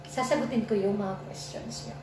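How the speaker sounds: ordinary delivery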